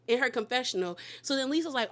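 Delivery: ordinary talk, nothing marked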